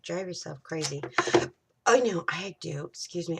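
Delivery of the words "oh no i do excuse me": talking through hiccups; hiccupping between words. Real, not acted. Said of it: "I've got the hiccups".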